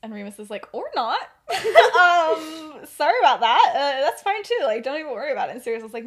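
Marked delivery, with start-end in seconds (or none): 0.7-5.5 flustered laughing backpedal. Acted. Remus backpedals after upsetting Sirius.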